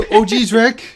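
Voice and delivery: high nervous Morty whine; stammering anxious teen voice. Shown as a demonstration. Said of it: "it's 'cause I was".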